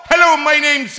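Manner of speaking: high, loud, hammed-up voice greeting; satnav accent voice. Host imitating a chirpy satnav voice, high and loud. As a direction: shout a cheery high hello like a satnav voice. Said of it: "I like the ones with accents".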